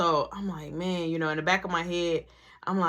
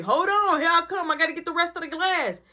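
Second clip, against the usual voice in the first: raised-pitch holler to someone waiting; calling back; 6 semitones higher, faster than usual. Retold or imitated. Teller calls back to brother that they're coming, calling out. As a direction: quickly holler that you're on your way.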